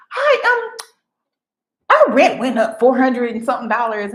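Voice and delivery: high, bright-pitched imitation of the neighbor; lightly mocking neighbor voice; neighbor voiced high. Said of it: "She was like".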